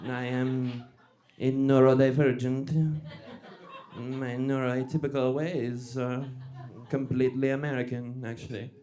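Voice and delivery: mock vaguely European singsong accent; halting lilt that sounds vaguely European. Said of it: "a little bit of the lilt".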